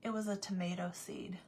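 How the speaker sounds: quiet, matter-of-fact letdown; retold deadpan reply. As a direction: imitate an unimpressed husband, softly. Husband's unimpressed answer, retold.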